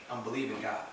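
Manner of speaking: quiet conviction. Real, not acted. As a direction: say it low and softly, as settled fact. Repeated declaration, settling down.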